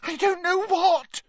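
high, tearful cry; sobbing. A narrator's reading. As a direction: wail helplessly through tears. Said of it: "sobbed Leanne".

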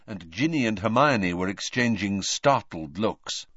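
ordinary delivery

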